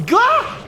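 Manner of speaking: startled gah. Real, not acted. Sudden game hit.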